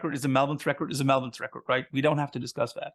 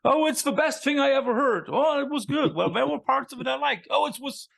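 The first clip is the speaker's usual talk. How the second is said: put-on higher-pitched grumpy old Muppet critics, lightly done; playful jab as two old hecklers flip-flopping; Waldorf and Statler impression; 7 semitones higher than usual. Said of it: "Isn't it like Waldorf and Stettler".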